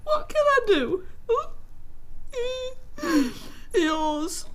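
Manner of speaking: high tearful wail signing off; utmost distress. Acted. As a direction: sob out the end of the letter. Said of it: "in utmost distress".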